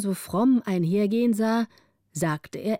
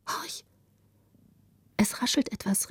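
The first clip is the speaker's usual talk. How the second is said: softly alert, barely voiced first word; hush, as if listening for a noise; hushed alarm; 5 dB quieter than usual. A narrator's reading. Greta hearing a rustle in the forest, hushed alarm. Hears rustling in dark woods.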